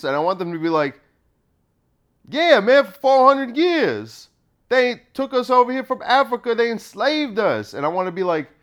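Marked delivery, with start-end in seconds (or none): 2.3-7.7 indignant. Acted. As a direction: argue back in mock outrage. Imagined indignant reply, acted.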